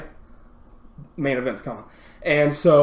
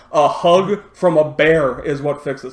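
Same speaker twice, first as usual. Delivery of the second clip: ordinary talk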